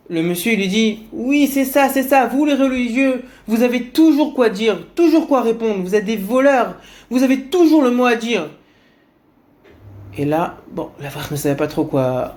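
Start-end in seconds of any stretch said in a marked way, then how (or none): 1.1-8.5 high, loud sneering rant; furious man mocking the religious; mock angry rant. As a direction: lay into him with contempt.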